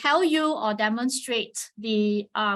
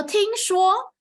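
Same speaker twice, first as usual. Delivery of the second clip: "听说" higher, louder and slow; 强调听说; emphasized; 9 semitones higher, 4 dB louder than usual. Shown as a demonstration. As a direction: lean hard on "听说". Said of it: "we call it 听说读写".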